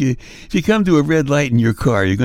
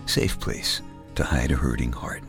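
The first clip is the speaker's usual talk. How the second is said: tucking in at bedtime; 4 semitones lower, 6 dB quieter than usual. Acted. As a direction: lull the listener gently to sleep. Tucking listener in to sleep.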